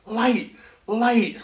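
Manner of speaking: high, wonder-struck single words; awestruck. Retold or imitated. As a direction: marvel at what has just appeared. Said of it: "he gasped".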